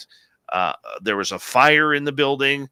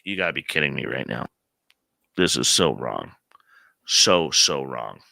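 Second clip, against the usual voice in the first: low, dragged-out stunned disbelief; facing a giant alien, low and stunned; 8 semitones lower than usual. Retold or imitated. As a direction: mutter it low like you can't believe your eyes. Dave reacting to seeing alien.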